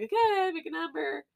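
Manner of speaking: high sweet coaxing; sing-song kid voice. Retold or imitated. Little sister running the fortune game, high coaxing. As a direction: coax her in a syrupy kid voice.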